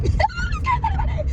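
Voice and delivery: giddy panicked giggle through the words; squealing laugh. Real, not acted. Vlogger laughing nervously at the police car beside her, high squeal.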